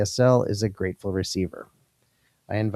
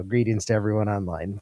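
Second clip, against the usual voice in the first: usual speaking voice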